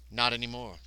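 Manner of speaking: normal talking voice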